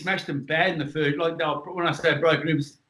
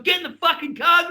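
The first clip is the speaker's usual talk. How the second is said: retold yell; 10 semitones higher than usual. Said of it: "H is yelling at me".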